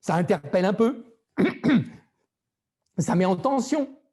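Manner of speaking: high-pitched, tense demonstration; high register. Shown as a demonstration. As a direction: pitch it up high to create tension. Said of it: "Il y a des temps pour l'aigu".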